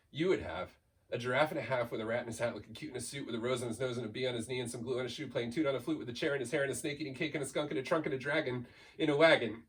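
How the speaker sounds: ordinary delivery, nothing marked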